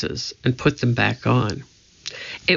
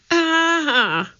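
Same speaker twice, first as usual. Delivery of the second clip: sudden high yelp of fright; high startled shriek; startled cry. A narrator's reading. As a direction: jolt out a shocked cry. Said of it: "he cried".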